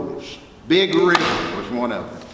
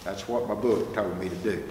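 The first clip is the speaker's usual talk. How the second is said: usual speaking voice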